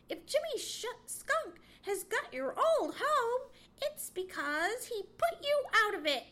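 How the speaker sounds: high, loud, sharp accusation; snapping squirrel. A narrator's reading. Chatterer contradicting Johnny about the skunk, snapping. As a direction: snap it out, pitched well up. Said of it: "he snapped".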